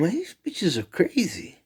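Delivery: very high complaint; high outraged voice. Retold or imitated. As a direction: whine it to mom. Telling mom.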